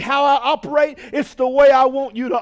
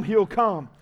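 ordinary delivery

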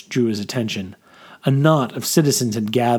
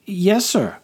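higher-pitched, obedient soldier's reply; eager private; 8 semitones higher than usual. Acted. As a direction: answer your officer promptly, pitched up. Private answers his officer.

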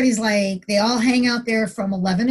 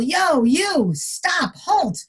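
retold yell; 6 semitones higher, much slower than usual. Officer retelling a challenge to a stranger at the fence, mock yell. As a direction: imitate yelling at a stranger to halt. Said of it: "and I yell".

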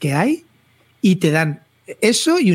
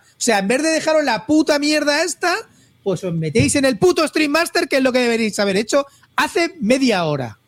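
exasperated; 7 semitones higher than usual. Real, not acted. Exasperated rant at the friends.

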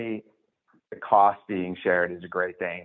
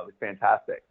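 ordinary talk, nothing marked

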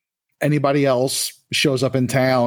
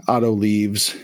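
ordinary delivery

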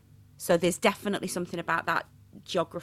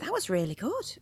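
imitating the producer; 7 semitones higher than usual. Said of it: "she went".

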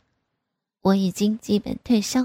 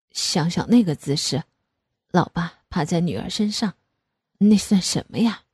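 father's voice; 3 semitones lower than usual. Acted.

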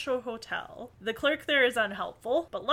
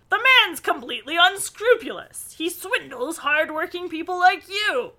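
loud, raised-pitch indignant accusation; acted outraged tirade; angry outburst; 7 semitones higher, 9 dB louder than usual. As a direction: denounce him loudly for all to hear. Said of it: "with an outburst".

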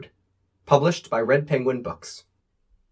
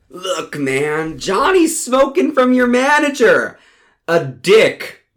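excited stoner joke; 6 semitones higher, 6 dB louder than usual. Acted. Stoned host teasing the guest, a gleeful high yell. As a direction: pitch jumps way up, louder, half laughing. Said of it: "says Johnny".

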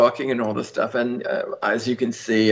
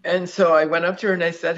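normal talking voice

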